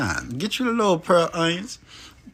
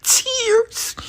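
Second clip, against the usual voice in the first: mock tears. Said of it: "it made me cry".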